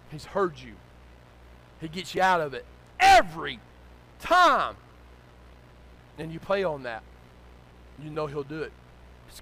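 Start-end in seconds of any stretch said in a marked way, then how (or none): 3.0-4.8 both words punched high and loud; emphatic shout. Real, not acted. Preacher driving home God's rescue, emphatic shout. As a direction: shout each word separately, with a gap.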